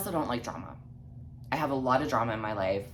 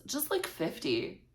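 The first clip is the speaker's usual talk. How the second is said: higher, soft, slow casual ask; mock nonchalance; 6 semitones higher, 6 dB quieter than usual. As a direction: imitate her tossing it off casually. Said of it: "all fucking nonchalantly".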